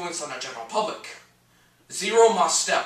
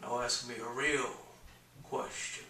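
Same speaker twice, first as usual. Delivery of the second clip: very soft and low; quiet, lowered challenge; hushed demand; 6 semitones lower, 12 dB quieter, slower than usual. Acted. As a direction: drop almost to nothing. Drops from bluster to a demand.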